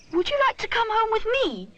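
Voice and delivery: put-on high, gentle boyish offer; young bear voice. A narrator's reading. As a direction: lift into a kind small boy. Rupert's kind offer.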